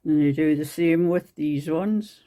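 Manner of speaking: talking around a needle held in the mouth. Real, not acted. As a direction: speak with a needle between the lips. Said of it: "I've got a needle in my mouth".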